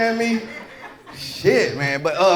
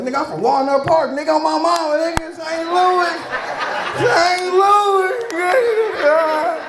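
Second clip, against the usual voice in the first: hammed-up hometown pride; 7 semitones higher, slower than usual. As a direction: overdo the street cred, pleading. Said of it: "so I'll be like".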